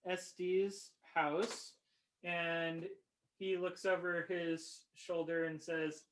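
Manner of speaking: ordinary delivery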